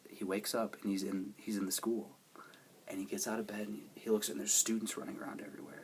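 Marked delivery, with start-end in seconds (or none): 2.9-5.8 hushed whisper. Real, not acted. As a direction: whisper it like a secret. Hushed retelling of waking scene.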